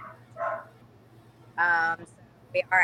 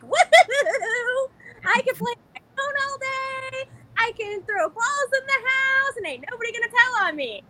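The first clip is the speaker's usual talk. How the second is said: put-on bratty kid voice. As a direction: play a wild twelve-year-old with no parent. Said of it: "and they're like".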